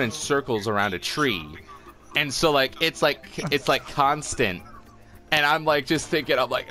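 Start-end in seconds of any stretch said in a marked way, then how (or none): none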